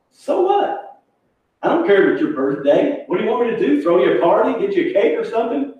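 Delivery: mock dismissive woman, voice jumping high; scornful retort. Friend brushes off the birthday girl, imitated scornful retort. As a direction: toss back needling questions with rising pitch.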